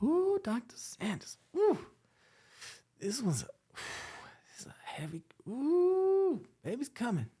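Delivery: very high, breathy moaning labour voice; woman in labour. Retold or imitated. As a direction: moan it like a mother mid-contraction. Imitating patient in labour.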